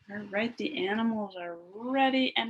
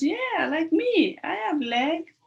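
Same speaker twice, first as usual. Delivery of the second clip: excited monkey; 7 semitones higher, 6 dB louder than usual. Acted. Teacher voicing the monkey for the children, higher and louder. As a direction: play the monkey bragging, pitched up and slowed. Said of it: "Monkey says".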